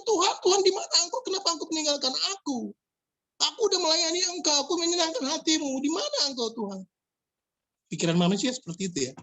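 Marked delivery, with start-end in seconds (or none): 0.1-6.8 complaining youth. Retold or imitated. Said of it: "Jadi dia komplain".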